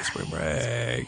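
low, soft "Spring break" fading away; low and quiet. Pretend. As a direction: let it drop low and quiet. Echo repeating catchphrase.

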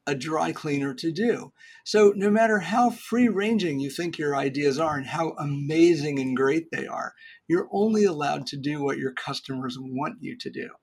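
normal talking voice

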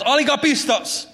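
high, chiding voice of Jesus repeating the word; Jesus scolding. Retold or imitated. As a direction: scold like a disappointed teacher. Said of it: "he constantly says it to his disciples".

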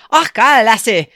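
loud, hammed-up Scottish burr; party-trick Scottish accent, punched out; attempted Scottish accent. Shown as a demonstration. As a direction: put on a brief mock Scottish brogue. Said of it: "my Scottish accent is this".

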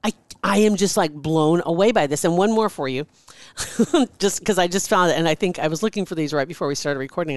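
ordinary delivery

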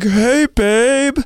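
a hint of a breezy star saying hi. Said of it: "She's like".

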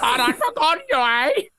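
high-pitched put-on voice; silly character voice. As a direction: keep up the squeaky comic character. Continuing the silly high impression bit.

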